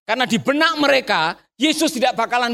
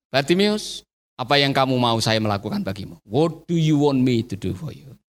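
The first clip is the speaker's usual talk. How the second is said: gentle Jesus; 11 semitones lower than usual. Acted. Jesus asking the blind man, low gentle voice. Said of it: "Dan dengar kalimatnya".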